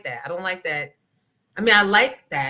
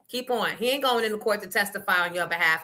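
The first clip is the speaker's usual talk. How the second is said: normal talking voice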